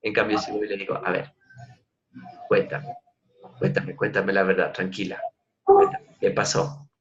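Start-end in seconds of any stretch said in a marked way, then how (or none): 1.0-6.9 softer, gentle coaxing; calm the daughter to get the truth; soft reassuring. Shown as a demonstration. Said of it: "Si le digo así, me suelta". (suave y tranquilizador, hablando a la hija)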